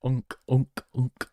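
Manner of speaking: hacking like a cat about to throw up; mock gag, three short retches; gagging cat sound. Shown as a demonstration. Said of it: "It sounds like a cat about to throw up".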